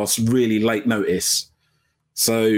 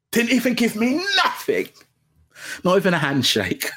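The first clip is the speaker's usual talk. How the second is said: higher, playfully wounded complaint; teasing mock sulk, pitched up; mock offended; 8 semitones higher than usual. Shown as a demonstration. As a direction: act hurt by the silence.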